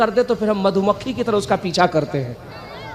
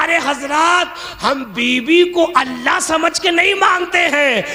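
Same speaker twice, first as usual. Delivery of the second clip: raised retort; 6 semitones higher, slower than usual. Retold or imitated. Husband answering the cleric back, raised indignant retort. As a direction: protest it emphatically, pitched up and rising in force.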